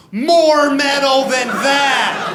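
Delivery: high, slow, loud punchline shout; mock outraged shout. Comic mocking the VH1 list with a hammed-up outraged shout. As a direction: bellow it in mock disbelief.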